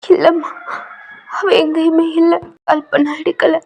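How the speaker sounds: distraught weeping mother; crying aunt. Acted. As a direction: sob it out in despair. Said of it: "உங்க anti செம்மையா அழுகுறாங்க".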